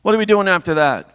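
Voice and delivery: high-pitched imitation of dad asking; lightly voiced dad, pitched well up; dad's question. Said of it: "He would say".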